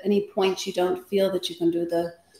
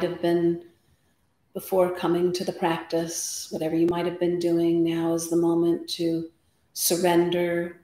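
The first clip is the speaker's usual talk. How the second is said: guided meditation. Acted. Yoga teacher settling the class before practice, guided meditation. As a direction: guide them into stillness, unhurried, phrase by phrase.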